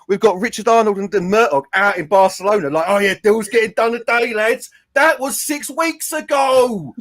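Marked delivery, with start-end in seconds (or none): 2.9-4.7 lightly voiced breezy club boss; mock executive boast. 4.9-6.9 drawn out, slow and dry; slow deadpan. Real, not acted. Deadpan punchline after the quote.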